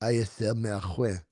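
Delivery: put-on soft French accent; understated French accent; French pronunciation. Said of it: "just dropping the French".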